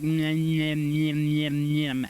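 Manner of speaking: playful munching sounds; chewing noises. Retold or imitated. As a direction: mimic nibbling, nyam nyam. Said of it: "you'd just be like".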